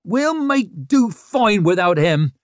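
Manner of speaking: loud, slow, high defiant roar. A narrator's reading. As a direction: roar it at the whole town. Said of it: "Ricker roared at the town in general".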